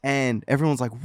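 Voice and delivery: ordinary talk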